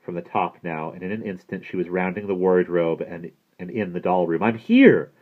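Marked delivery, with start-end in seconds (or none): 4.4-5.1 loud, raised arrival cry. A narrator's reading. Maggie bursts into the doll room, crying out. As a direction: announce yourself at full volume.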